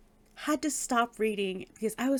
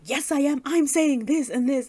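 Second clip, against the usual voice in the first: loud, higher taunting comeback; brazen contradiction; 5 semitones higher, 8 dB louder than usual. Retold or imitated. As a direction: butt in defiantly at full volume. Alexis brazenly contradicts Ruby.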